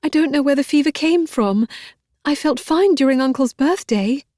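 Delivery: pleading through sobs; distraught girl crying out her sorry; sobbing. A narrator's reading. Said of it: "Elizabeth sobbed".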